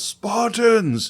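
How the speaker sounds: high-pitched imitation of a rallying yell; mock battle yell. Leonidas gets up and calls his Spartans, mock yell. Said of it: "gets up and yells".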